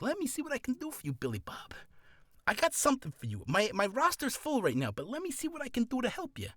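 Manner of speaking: high, hammed-up agent voice; agent's reply. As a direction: play the agent musing over an old client. Acts the agent answering an old client.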